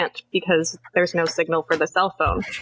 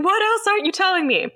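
mock scream; 12 semitones higher than usual. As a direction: imitate an exasperated scream without full volume. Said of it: "Gordon's screaming at him".

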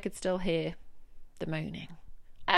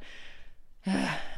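sigh; 4 dB quieter than usual. Real, not acted. No one cares.

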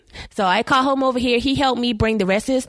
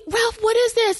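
mock solemn sincerity; 12 semitones higher than usual. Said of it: "Trying to be all, like, solemn and sincere".

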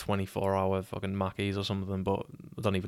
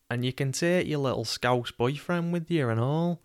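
higher, mocking Manc voice; Manc accent; 5 semitones higher than usual. Lad sending the girl off, jeering Manc accent. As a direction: jeer in a put-on Manc accent. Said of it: "I'm terrible at a Manc accent".

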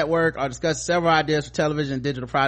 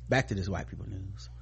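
ordinary talk, nothing marked

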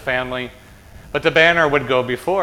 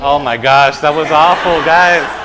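loud playful scolding; mock dismay; 8 dB louder, slower than usual. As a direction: chide the group loudly for a weak effort. Leader chides weak group shout.

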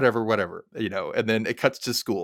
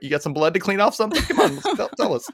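teasing laugh; 8 semitones higher, faster than usual. Acted. Teasing a suspect character.

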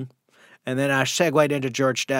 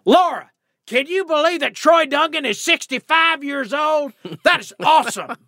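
George W. Bush impression; 13 semitones higher than usual. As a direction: play Bush gleefully announcing a secret to his wife. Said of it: "and he says".

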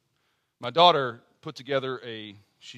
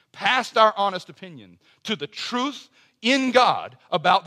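rising, insistent pulpit cadence; preacher lifting the congregation toward hope; preaching; 4 semitones higher, 4 dB louder than usual. Acted. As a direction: build the appeal line by line, louder. Opening exhortation of the sermon.

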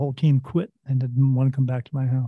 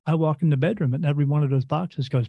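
ordinary talk, nothing marked

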